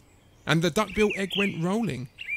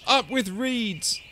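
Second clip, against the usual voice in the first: high, slow joyful chant; happy herd slogan; hooting; 6 semitones higher, slower than usual. A narrator's reading. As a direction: hoot it, stretching the words. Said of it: "they hooted".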